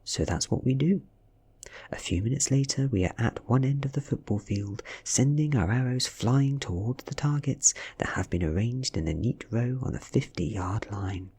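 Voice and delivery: usual speaking voice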